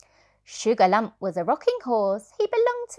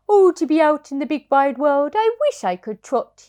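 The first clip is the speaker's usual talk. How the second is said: higher, louder longing sigh of a horse; wistful horse voice; 6 semitones higher, 7 dB louder than usual. Acted. Bedtime reader voicing restless horse's wish. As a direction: yearn for the wide world. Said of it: "he said".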